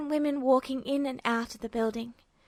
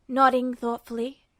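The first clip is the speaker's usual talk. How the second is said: ordinary delivery, nothing marked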